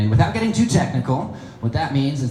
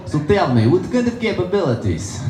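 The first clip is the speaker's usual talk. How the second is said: higher-pitched Russian astronaut; Russian accent; 8 semitones higher than usual. Acted. Russian astronaut quizzing a rival on space station, Russian accent. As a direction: probe slyly in a Russian accent. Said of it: "the Russian guy would be like".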